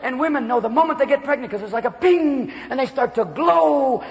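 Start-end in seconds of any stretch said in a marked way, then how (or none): none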